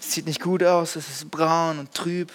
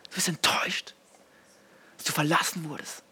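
whispered; 4 dB quieter than usual. Acted. Whispered disappointment.

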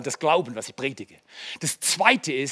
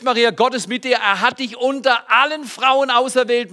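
normal talking voice

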